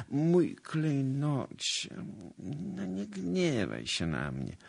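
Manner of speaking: high, drawn-out pleading without force; plaintive. A narrator's reading. Guilty suitor begging his lady not to be angry, plaintive. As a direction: coax pitifully, stretching the endearment. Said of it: "zawołał wreszcie żalosnym głosem".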